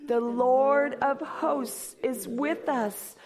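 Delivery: slow, reverent reading; solemn psalm refrain. Acted. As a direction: intone the refrain slowly.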